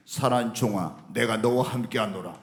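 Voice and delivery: low, solemn voice of the Lord; voice of God. Retold or imitated. Pastor quoting God's promise, low and solemn. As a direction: deliver it as God, pitched well down. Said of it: "주님이".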